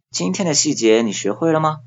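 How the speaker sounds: ordinary talk, nothing marked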